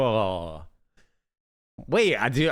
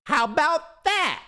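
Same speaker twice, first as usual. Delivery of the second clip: high mock voice. Mock voice flourish.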